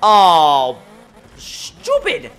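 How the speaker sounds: a touch of a dopey talking gearbox, drawn-out words; lightly voiced gearbox. Driver mocking his automatic gearbox that won't shift, mock gearbox voice. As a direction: give the balky gearbox a voice. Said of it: "the automatic gearbox in this car is like".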